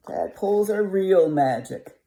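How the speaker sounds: Mary Mole's voice. Acted. Reader fixes the voice for Mary Mole, a storybook character voice. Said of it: "Got the wrong voice there".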